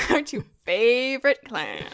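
heavily sarcastic, words pulled long. Shown as a demonstration. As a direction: stretch it out, dripping with sarcasm. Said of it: "need to sound way more sarcastic".

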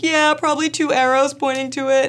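high, loud whine; mock-tearful answer. Wife telling husband what to add, tearful.

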